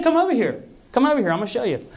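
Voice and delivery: high, eager call to come see; excited friend beckoning; excited urging. Retold or imitated.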